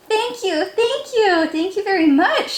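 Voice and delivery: high, eager thank-yous from a tiny fish; little fish gushing with thanks; grateful little fish. A narrator's reading. Little blue fish thanking rainbow fish, high and eager.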